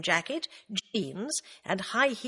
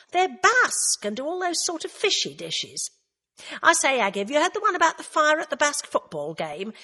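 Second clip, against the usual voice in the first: higher, bouncy gossiping friend voice; chatty camp Roy, lively and teasing; 9 semitones higher, 5 dB louder than usual. A narrator's reading. Roy teasing Agatha about the pub, higher, camp and chatty. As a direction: tease her like an old office pal. Roy tells a pun joke.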